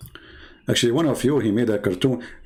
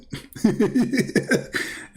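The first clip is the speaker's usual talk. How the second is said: squeaky mock cackle; 12 semitones higher than usual. Mock caller laughing.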